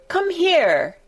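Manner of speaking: high furious shout. Acted. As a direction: yell the order across the garden. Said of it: "he shouted to Dickon".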